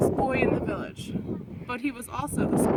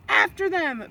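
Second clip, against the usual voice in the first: bellowed; 4 semitones higher, 5 dB louder than usual. A narrator's reading. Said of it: "bellowed the Vikings".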